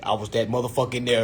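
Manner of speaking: ordinary delivery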